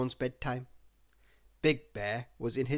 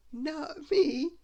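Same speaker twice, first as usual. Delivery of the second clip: put-on high girlish protest; high little protest; Little Miss Sunshine crying out; 13 semitones higher than usual. A narrator's reading. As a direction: deny it, high and quick. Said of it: "she cried".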